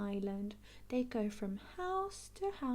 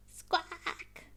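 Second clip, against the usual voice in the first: parrot squawk; 7 semitones higher than usual. Acted.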